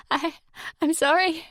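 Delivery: high, broken stammer; stammering fear. Acted. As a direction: stumble out the apology, terrified. Stammering in terror.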